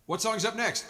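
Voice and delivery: a high, loud call from the stage; band bit. Retold or imitated. Musician calling out to his old band, high and loud. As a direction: call out the next song to the band, bright and loud. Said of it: "as we used to say with the full band".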